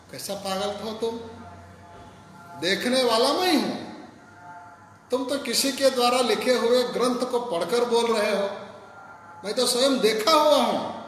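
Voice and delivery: high, loud, rushing scold; furious outburst. A narrator's reading. Hanuman berating Ramdas for trusting books over him, furious loud voice. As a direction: blast him for his stubbornness. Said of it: "Hanuman जी को कितना गुस्सा आया होगा".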